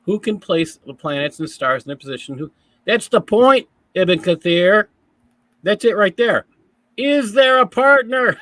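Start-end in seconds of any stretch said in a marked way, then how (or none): 2.8-8.4 loud, high burst of triumph; excited exclamation. Real, not acted. Pointing out the passage's flaw to co-host, excited. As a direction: pounce on it, much louder and higher. Seizing on the flaw, laughing after.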